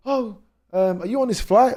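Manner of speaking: put-on high voice, rising through the question; imitated lady stranger. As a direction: pitch it well up, mimicking a chatty stranger.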